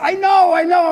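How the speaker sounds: high, loud "I know" yelled back; frantic shout. Retold or imitated. Shouting back at yelling people.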